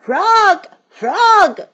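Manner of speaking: high loud call across the yard. A narrator's reading. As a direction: call your friend eagerly. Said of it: "cried Toad".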